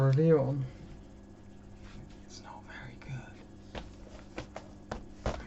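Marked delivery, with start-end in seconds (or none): 2.2-5.3 a hushed whispered aside. Real, not acted. Confiding to viewers that the album is weak, whispered. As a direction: breathe it out as a secret.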